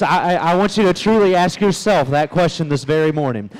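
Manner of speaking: ordinary talk, nothing marked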